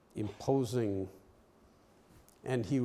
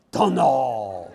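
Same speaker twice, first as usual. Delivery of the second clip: mock Korean accent; 10 dB louder than usual. Shown as a demonstration. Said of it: "if you say it with a Korean accent".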